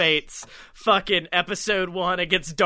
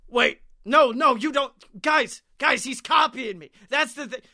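higher, urgent pleading; mock desperate protest; 6 semitones higher than usual. Real ally pleading with friends, higher urgent protest. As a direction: insist you're the real one. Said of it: "it's like".

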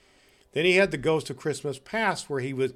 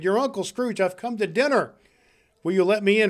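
high, eager calling; hearty announcement; 7 semitones higher, 5 dB louder than usual. Acted. Scrooge at his nephew's door, eagerly announcing himself. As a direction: announce yourself with glad excitement.

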